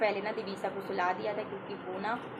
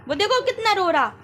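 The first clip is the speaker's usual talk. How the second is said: scolding; 13 semitones higher, 9 dB louder than usual. Real, not acted. Mother loudly scolds her toddler while the son cries. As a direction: tell her off for hurting her brother.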